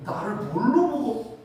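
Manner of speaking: acted-out indignant protest, high-pitched; wounded pride. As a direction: insist on your worth, offended. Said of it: "너무 서운한 거야". (자존심 상해 서운하게 항의하듯)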